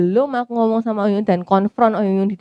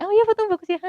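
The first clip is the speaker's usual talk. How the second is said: high, insincere, sing-song praise; mock fake "Oh iya"; fake compliment; 12 semitones higher, faster than usual. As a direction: say "fotomu bagus ya" as hollow politeness. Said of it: "Nggak kayak".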